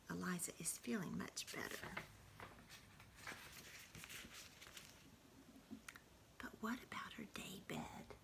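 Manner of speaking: hushed bedtime reading without voice; whispered bedtime story. Acted. Grandmother whispering a bedtime story to the grandchild. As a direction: whisper the story to a sleepy child.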